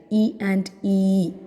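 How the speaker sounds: E short as in chin, then E drawn out; short and long E. Shown as a demonstration. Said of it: "while we were studying vowel sounds". (E ആദ്യം "ഇ", പിന്നെ "ഈ")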